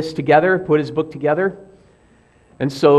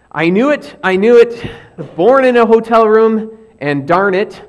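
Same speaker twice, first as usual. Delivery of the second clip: raised pitch, louder, mildly hammed-up protest; mock exasperated outburst; 6 semitones higher, 5 dB louder than usual. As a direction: act out an exasperated last complaint. Acting out O'Neill's dying outburst.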